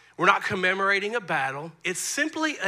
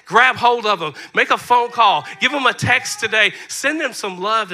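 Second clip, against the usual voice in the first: punchy, insistent preaching; 6 dB louder than usual. Real, not acted. Pastor urging church to thank their gap fillers, preaching. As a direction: drive it home to the congregation.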